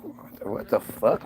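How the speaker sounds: whispered disbelief under the breath; whispered 'what the fuck'; whispered aside. Pretend. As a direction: mutter it so nobody hears. Reading the contract, whispered.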